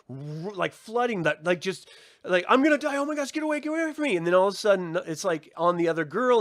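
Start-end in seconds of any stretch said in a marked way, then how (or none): 2.5-4.2 much higher, louder frightened squeal; mock panic. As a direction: play the terrified kid fleeing the monster. Voicing Ellie's panic at the clicker.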